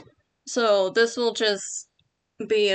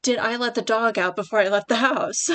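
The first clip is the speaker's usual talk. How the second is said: sudden inner thought; 3 semitones higher, 5 dB louder than usual. Retold or imitated. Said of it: "a thought pops up like".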